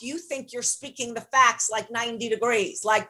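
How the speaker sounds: ordinary talk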